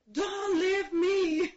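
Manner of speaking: slow, high, loud begging; retold plea to her husband at the door, slow and loud; desperate plea. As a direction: drag each word out, begging him to stay.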